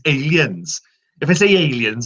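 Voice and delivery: lilting Welsh accent. Shown as a demonstration. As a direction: slip into Welsh from the word "aliens". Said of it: "my trigger for the Welsh accent".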